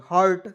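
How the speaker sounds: हर्ट with a rolled r, stressed; हर्ट said slowly and clearly as hurt. Shown as a demonstration. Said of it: "आर को रेफ लाएंगे". (हर्ट को साफ़ बोलें)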